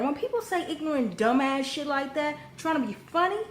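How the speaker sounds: ordinary delivery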